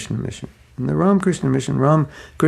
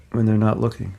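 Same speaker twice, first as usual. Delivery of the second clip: ordinary talk, nothing marked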